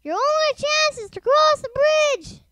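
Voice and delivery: high, loud urgent call; urgent shout, pitched very high. A narrator's reading. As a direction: call it across from a distance. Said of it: "called Percy".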